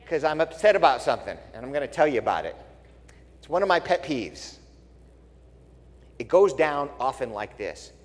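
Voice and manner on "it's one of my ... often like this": ordinary delivery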